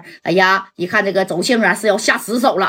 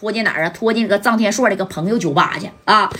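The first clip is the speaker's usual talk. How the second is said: ordinary delivery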